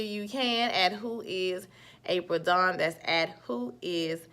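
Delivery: slow, deliberate handle read; slow and clear. Real, not acted. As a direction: spell the handle out slowly. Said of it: "spelling out her handle".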